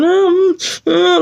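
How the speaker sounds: high moaning sobs, imitated; imitated crying. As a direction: mimic short high wails of distress. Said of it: "she was doing all this".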